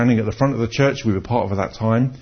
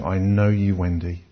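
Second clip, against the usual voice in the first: gentle divine message; 7 semitones lower, 6 dB quieter than usual. Retold or imitated. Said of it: "And God is saying".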